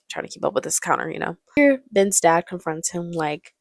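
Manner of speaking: normal talking voice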